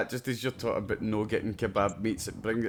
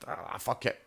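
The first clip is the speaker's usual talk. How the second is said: muttered; 8 dB quieter than usual. Retold or imitated. Resigned inner thought.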